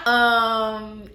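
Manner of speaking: laughing through the retort. Real, not acted. Narrator laughing at her own thought about the client. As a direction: crack up mid-line.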